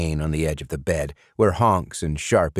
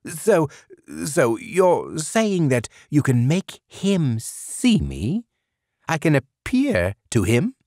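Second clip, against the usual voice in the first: much higher, slow, stammering; can hardly believe it; breathless hope; 10 semitones higher, slower than usual. A narrator's reading. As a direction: sleep demon hoping he can be seen. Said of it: "and said".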